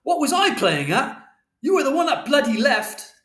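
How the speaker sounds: loud, high, accusing yell; angry shout. A narrator's reading. Father blaming Mother for leaving, shouting high. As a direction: throw the accusation back, yelling. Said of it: "And Father shouted".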